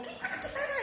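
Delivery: very high, held-back mock yell; re-enacted shout. Mother explaining why she cried out, re-enacted shout. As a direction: imitate a frantic cry without full volume. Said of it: "dengan berteriak".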